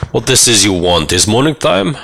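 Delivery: a hint of a Borat accent. Drunk prince greeting a stranger, lightly Borat-like accent. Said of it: "The accent is a bit like Borat".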